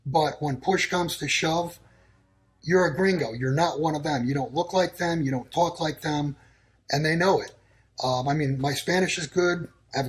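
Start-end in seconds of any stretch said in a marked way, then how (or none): none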